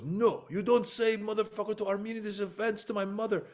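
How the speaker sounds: high, put-on Armenian accent, indignant; offended Armenian heckler. Offended Armenian fan scolding the comedian, high and indignant in an Armenian accent. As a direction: play the insulted Armenian guy in broken English. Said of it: "because he's Armenian".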